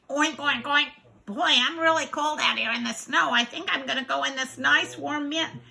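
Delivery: oink, then talk as a little pig. Acted.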